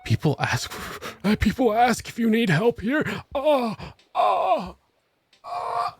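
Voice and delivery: high-pitched, overcome astonishment; mock amazement. Floored by a coworker offering help, mock amazement. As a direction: act floored, laugh breaking in, high oh's.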